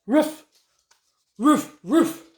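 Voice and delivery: short playful dog barks; lightly voiced dog barks; barking dog. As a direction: bark like a friendly pooch. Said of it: "barked the pooch right away".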